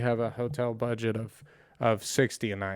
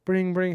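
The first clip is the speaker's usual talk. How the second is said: higher-pitched sung-out ringing; imitated phone ring; 6 semitones higher than usual. Storyteller ten minutes later, imitating the hotel's phone call ringing. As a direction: mimic a telephone ringing twice.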